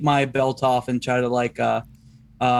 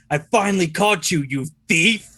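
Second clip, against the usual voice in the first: slightly raised, declamatory accusation; announcing a capture; 4 semitones higher than usual. Acted. Detective to thief before everyone around, declaiming accusation. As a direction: proclaim it for onlookers.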